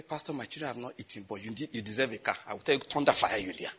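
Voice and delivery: low, soft acted confession; humble needy giver. Needy member offering pastor a car, meek hushed voice.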